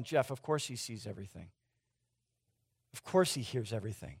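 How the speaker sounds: low, soft bored mock voice of a jaded believer; jaded churchgoer. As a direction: shrug it off like an old-timer who's heard it all. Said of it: "you're probably sitting back going".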